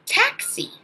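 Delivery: teacher comparing first sounds; comparison demo. Shown as a demonstration. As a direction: hit the t of "taxi." hard. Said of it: "same sound as".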